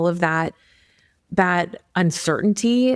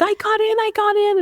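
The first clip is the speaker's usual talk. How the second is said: much higher, bursting with news; student shrieking that she got in; excited squeal. Retold or imitated. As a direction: squeal the news like you can't believe it. Maria's excited message retold.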